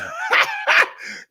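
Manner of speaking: shrill cackle. Retold or imitated. High laugh inside the impression.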